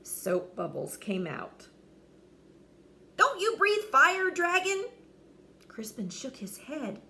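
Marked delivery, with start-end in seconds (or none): 3.2-4.9 high knight voice. Acted. Knight puzzled.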